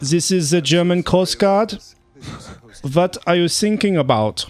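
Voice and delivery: put-on slow, high, loud German accent; nervous German coast guard mishearing. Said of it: "he's all nervous and he's like".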